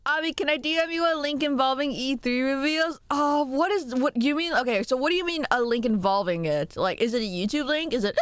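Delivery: usual speaking voice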